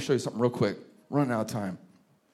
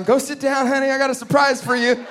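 sweet wife's voice; 10 semitones higher, 4 dB louder than usual. Retold or imitated. Wife calls him to table.

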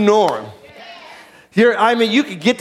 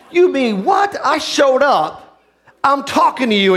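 higher-pitched, affronted comeback; mock indignation; 7 semitones higher, slower than usual. Preacher voices God answering arguing Abram, mock indignant. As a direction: act out being offended at the ungrateful reply.

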